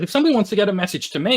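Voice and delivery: fast, high, loud quote; acted-out quickfire argument; quick argumentative retort. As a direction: rattle off an objection. Bruce argues back in retelling.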